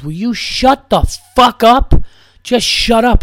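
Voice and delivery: high, loud and slow, fed up; furious shout at heckler. Retold or imitated. Comedian on stage yelling at a loud drunk woman, furious. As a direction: bark it at the heckler, slowly.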